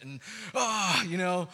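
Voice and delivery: normal talking voice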